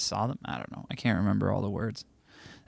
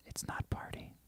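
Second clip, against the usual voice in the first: whisper; 19 dB quieter than usual. Pretend. Whispering the chat line back.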